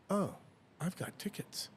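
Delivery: hushed, whispered thought; private realization. Retold or imitated. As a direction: whisper it as a sudden thought to yourself. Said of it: "I think in the back of my mind".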